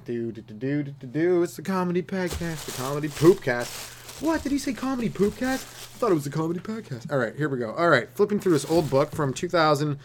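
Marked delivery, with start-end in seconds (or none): 0.1-4.2 light, soft jingle delivery; a quiet, playful show intro; mock jingle voice. Comedian announcing his own podcast to himself, mock jingle voice. 4.2-7.1 puzzled listener. Pretend. Plays puzzled listener to own jingle.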